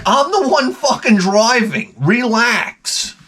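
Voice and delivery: high, drawn-out mock outburst; exasperated. Husband tells his back-seat-driving wife off, exasperated. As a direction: protest in a strained high pitch, stretching the words. Acts out snapping at watchful wife.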